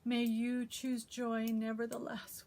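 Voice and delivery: normal talking voice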